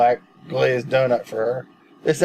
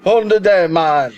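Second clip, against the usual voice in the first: put-on louder, higher Jamaican accent retry; 6 semitones higher, 6 dB louder than usual. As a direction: push the Jamaican accent harder. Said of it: "Hold on".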